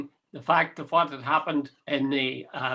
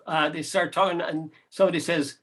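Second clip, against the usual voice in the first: ordinary talk, nothing marked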